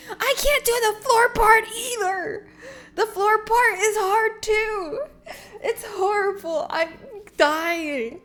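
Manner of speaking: high, loud hammed-up sobbing complaint; whiny, drawn-out melodrama; mock-crying wail. Real, not acted. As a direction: wail as if dying, comically. Wailing about floor routine.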